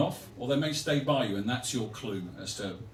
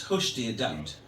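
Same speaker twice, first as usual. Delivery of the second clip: lower, dropping West Midlands hush; pained hushing; 3 semitones lower, slower than usual. Acted. Husband hushing his pained wife, low West Midlands murmur. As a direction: hush them with hurt held back. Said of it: "the pain's in her voice".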